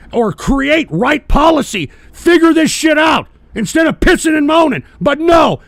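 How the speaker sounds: loud, high furious yell; angry shout. Real, not acted. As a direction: bark it at them in full anger. Ranting at complaining viewers.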